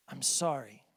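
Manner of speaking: mean every word of it, gently; sincere apology. Shown as a demonstration. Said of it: "reach down deep inside and find that sincerity".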